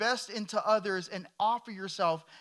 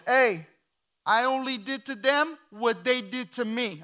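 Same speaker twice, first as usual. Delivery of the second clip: hammed-up Italian accent, loud opening; comic Italian-accented retort; 3 semitones higher, 4 dB louder than usual. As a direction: answer back like a cocky Italian. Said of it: "in an Italian accent".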